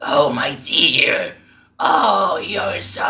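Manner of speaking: mock golem voice, drawn out and rising; slow creature whine, mocking a singer; golem imitation. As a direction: do a hammed-up golem. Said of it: "you're sounding like a golem".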